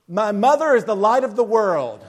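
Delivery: proud, booming recital; clear, loud voice. Retold or imitated. Little boy reciting in his Christmas play, very clear and loud. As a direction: belt it out clearly to the whole audience. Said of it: "with a very clear and loud voice".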